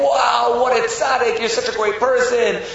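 high, thrilled praise; acted crowd full of wonder; gushing admiration. Townspeople clapping him on the back, gushing.

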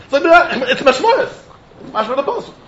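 high-pitched, insistent retort; animated insistence. Retold or imitated. Teacher giving the Ran's simple answer, animated and insistent. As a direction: pitch it up as if the answer were obvious.

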